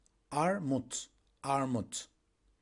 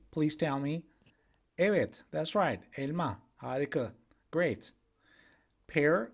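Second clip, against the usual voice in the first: ordinary talk, nothing marked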